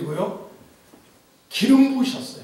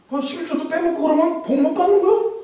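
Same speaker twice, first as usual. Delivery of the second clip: ordinary talk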